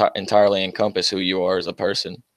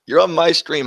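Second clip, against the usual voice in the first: raised and higher, half laughing; amused raised retort; louder, higher laughing retort; 7 semitones higher, 4 dB louder than usual. Real, not acted. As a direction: fling it back louder through a laugh. Third repeat, laughing.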